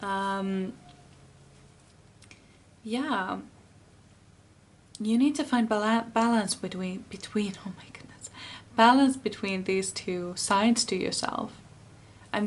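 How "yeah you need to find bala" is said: normal talking voice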